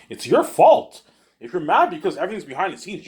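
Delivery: real angry outburst, high and loud; loud, high-pitched accusing jab; angry accusation. Real, not acted. Ranting at those who left him.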